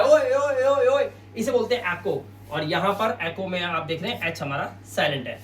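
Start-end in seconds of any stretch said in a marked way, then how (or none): 0.0-1.1 high, loud repeated calls like an echo; echoing "oy" calls, raised voice; imitated echo. Shown as a demonstration. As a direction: mimic a shout bouncing round an empty room. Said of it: "ये sound गूंजता है".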